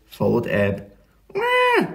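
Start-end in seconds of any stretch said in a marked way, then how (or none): 1.3-1.9 very high, drawn-out wail; squeaky animal wah; baby seal cry. A narrator's reading. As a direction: bleat like a lost baby seal. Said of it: "went the baby seal".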